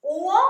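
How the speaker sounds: उओ drawn out with rounded lips, rising; exaggerated lip rounding on उओ; teacher modelling उओ slowly for the class; mouth shape demo. Shown as a demonstration. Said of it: "मेरे मुंह की तरफ देखिए कैसे मैं बोल रही हूं".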